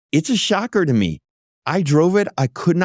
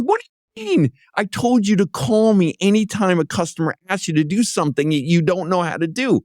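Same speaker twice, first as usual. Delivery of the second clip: pitched-up, mildly exasperated telling-off; retold scolding; 6 semitones higher than usual. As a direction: replay the boss's raised-voice reprimand. Boss scolds driver, retold.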